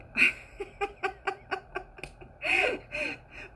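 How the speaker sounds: a high, sudden burst of real laughter. Real, not acted. Cracking up at a thought heard mid-sentence, real laugh. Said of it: "I'm just laughing 'cause that's what I just heard".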